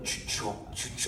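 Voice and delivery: whispered skittering sound; rustling noise. Retold or imitated. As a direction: imitate leaves crunching. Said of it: "something would just be like".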